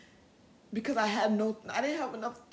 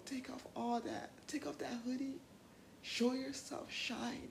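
whispered coaxing; 8 dB quieter, slower than usual. Shown as a demonstration. Coaxing her inner teen.

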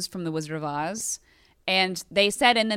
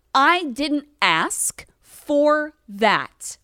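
each word drawn out, pitch raised; slow indignant emphasis; 5 semitones higher, 4 dB louder, much slower than usual. Real, not acted. Podcaster to message senders, indignant and emphatic. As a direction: spell it out slowly, fed up.

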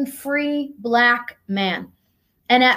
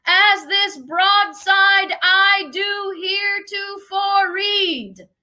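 town crier; 7 semitones higher than usual. Acted. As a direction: call it out like a crier reading a proclamation. Reading the Declaration aloud to the crowd.

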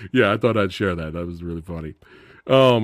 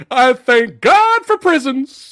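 high, loud, slow laughing shout; 13 semitones higher, 8 dB louder, slower than usual. Retold or imitated. Retelling Pryor's relief after the inmate's answer, laughing shout. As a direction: bellow it through laughter.

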